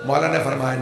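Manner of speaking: normal talking voice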